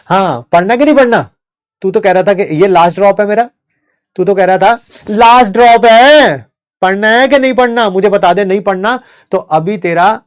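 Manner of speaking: mock father yelling while smacking; hammed-up dad scolding a lazy son; 1 angry father. Teacher acts angry father's beating.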